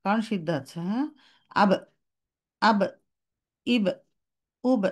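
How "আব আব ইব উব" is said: short bouncing ab, ab, ib, ub; crisp qalqala on ba; clean bouncing ba. Shown as a demonstration. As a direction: let the ba bounce and cut. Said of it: "এরকম".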